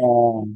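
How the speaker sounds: অ said as a soft nasal ng, low and quiet; nasal ng sound. Shown as a demonstration. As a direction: hum the অ through the nose like ng. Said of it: "এটার উচ্চারণ হলো".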